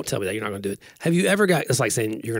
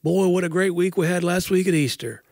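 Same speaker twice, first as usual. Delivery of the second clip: high-pitched, put-on pastor's welcome; mock sermon small talk; 7 semitones higher than usual. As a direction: parody a preacher warming up the congregation. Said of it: "when you're doing a sermon".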